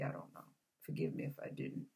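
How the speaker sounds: quick unvoiced whisper under the breath; a fast, sheepish whisper to herself; whispered aside. Real, not acted. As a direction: toss it off as a hushed apology. Muttered aside after a word slip.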